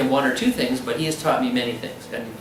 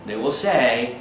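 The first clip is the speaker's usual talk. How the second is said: ordinary talk, nothing marked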